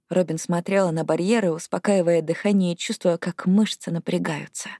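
ordinary delivery

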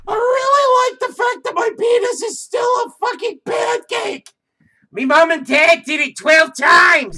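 shrill high mock shout; overacted squealing character; hammed-up squeal.

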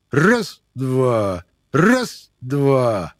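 loud, high counting bark; shouting drill sergeant. Acted. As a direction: drill the recruits hard. Sergeant counts push-ups.

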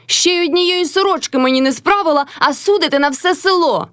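high-pitched scolding retort; crying out. A narrator's reading. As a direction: throw the reproach out high. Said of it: "крикнула Мотря".

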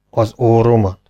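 a mild grumble; grumbling. A narrator's reading. Hero reads the parrot's letter, mild grumbling. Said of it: "morogta a ténsúr".

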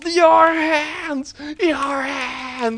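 high, hammed-up wailing shriek; manic hysteria. Crazed teacher chasing his pupil, manic high wail. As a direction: wail it wildly, stretching out hands. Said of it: "he's bouncing off the walls".

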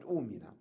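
أؤمن said oo-min, with no catch; hamza turned to waw. Shown as a demonstration. Teacher showing what it became, long oo. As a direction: hold a smooth oo to open أؤمن. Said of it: "فتقلب إلى واو".

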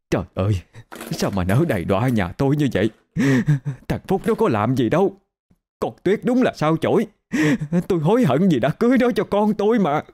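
a frantic mother ranting as her voice climbs; furious lament. Acted. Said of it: "bà điên lên".

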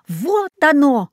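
high, slow joyful exclamation; joyful bark. A narrator's reading. Dog finds the highway, joyful bark. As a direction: proclaim it happily, drawn out and high. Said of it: "рявкнул он радостно".

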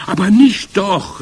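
loud, high protest; calling out. A narrator's reading. Other witches contradict the sniffing witch, calling out. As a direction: cry it out in disbelief. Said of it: "riefen die anderen".

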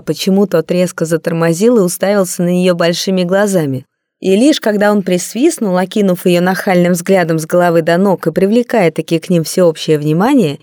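ordinary delivery

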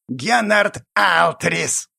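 slow, creaky goblin rasp. A narrator's reading. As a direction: grate the name out slowly. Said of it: "жутко скрипучим голосом".

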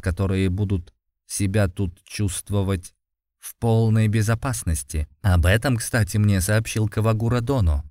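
usual speaking voice

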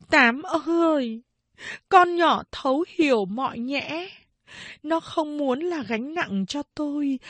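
high, keening cry for a lost daughter; grieving lament. A narrator's reading. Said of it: "lẩm bẩm".

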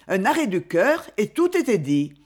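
usual speaking voice

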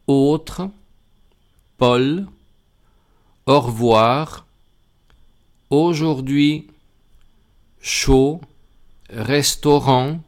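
Australie, aussi, autre, Paul, au revoir, aujourd'hui, chaud, restaurant, jaune read slowly in French, each AU as a closed O; French words with AU said as O. Shown as a demonstration. Teacher reads French examples aloud to Spanish learners, carefully enunciated. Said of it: "Voy a leer esos ejemplos".

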